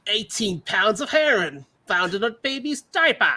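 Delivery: high, hammed-up Australian TV narrator; bad Australian accent. Parody customs narrator reporting a seizure, bad Australian accent. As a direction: announce it in comically poor Australian. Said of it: "Today on Accents Done Poorly".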